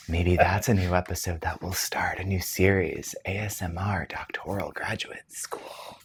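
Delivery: soft ASMR whisper. Shown as a demonstration. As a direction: whisper it close to the mic. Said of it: "This is my A S M R voice".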